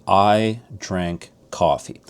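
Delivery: slow, deliberate demo of I drink coffee; slow example. Shown as a demonstration. Teacher slowly modeling English word order for learners. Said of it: "S V O languages".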